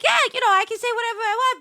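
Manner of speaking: eager explaining. Retold or imitated. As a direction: gush to the parents. Explaining streaming to parents.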